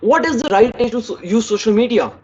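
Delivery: 1 quick question tone. Shown as a demonstration. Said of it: "This was the first question".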